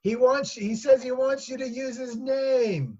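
high, slow relaying call. Acted. Daughter passing husband's demand to mother, calling out. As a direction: call it up the stairs, drawn out.

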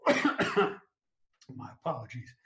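strained voice after a coughing fit; choking. Real, not acted. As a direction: croak it out slowly past a cough. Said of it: "I got you so excited you're choking".

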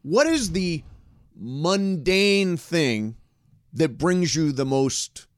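drawn out like a game host; slow drawn out question. Real, not acted. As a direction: stretch each word slowly. Posing the question slowly.

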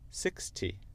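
British sixty with a crisp T; British demo, T of sixty sounded; British accent. Shown as a demonstration. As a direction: say sixty the British way, six-tee. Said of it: "et après l'accent britannique".

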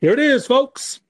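high, bright reveal; announcer voice. Pretend. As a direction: present it like a big unveil. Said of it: "before we can actually say".

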